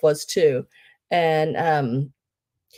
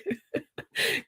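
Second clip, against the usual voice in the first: breathy laugh; 11 semitones higher, 9 dB quieter than usual. Real, not acted. Laughing at her own knee trouble.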